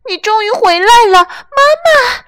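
overjoyed lamb. Acted. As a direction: squeal with joy like a lamb. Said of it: "雷尼高兴极了".

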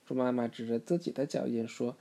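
ordinary delivery

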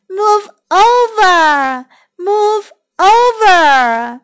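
sing-out "let me aboard" call. Acted. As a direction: call slowly across the water, stretching the vowels. Another voice calling from water.

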